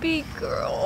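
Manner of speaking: high, soft coo; cooing pity. Real, not acted. Owner pitying her skinny stray cat, cooing. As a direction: coo it high and softly to a pitiful pet.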